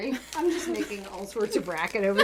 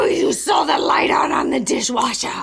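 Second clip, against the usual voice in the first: loud, higher mock angry shout; 6 semitones higher, 7 dB louder than usual. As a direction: snap at your husband about the dishwasher, loud. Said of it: "that's just my angry voice".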